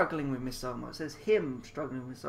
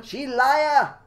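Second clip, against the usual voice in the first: loud, high shout in a put-on voice; angry shout; 10 semitones higher, 12 dB louder than usual. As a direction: bark it at her. Man shouts at maid.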